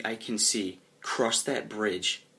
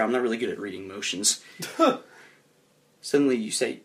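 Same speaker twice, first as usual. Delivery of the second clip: ordinary talk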